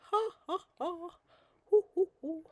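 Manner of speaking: high put-on ha ha ha, then hoo hoo hoo; a hammed-up cackle, high and theatrical; mock laugh. Laughing at the light glaring on her name, high mock laugh.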